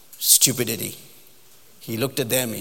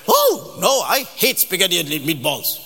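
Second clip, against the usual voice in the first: loud, high cry of refusal; mock horrified outburst; 5 semitones higher, 4 dB louder than usual. Date answering the spaghetti question, horrified. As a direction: recoil with exaggerated dismay.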